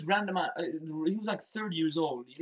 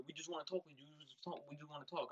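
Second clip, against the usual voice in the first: soft coaxing; 18 dB quieter than usual. Retold or imitated. The stranger luring the boys downstairs, hushed coaxing. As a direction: coax them quietly, nothing to see here.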